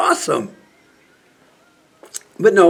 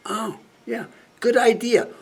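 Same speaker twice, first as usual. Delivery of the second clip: sarcastic approval; 7 semitones higher than usual. Acted. Mocking a senator's plan to bomb Russia, sarcastic approval. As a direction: feign delight at a terrible idea.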